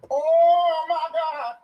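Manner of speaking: high, slow, drawn-out gasp of shock; stunned Auntie. Retold or imitated. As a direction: stretch it out like Auntie can't believe it.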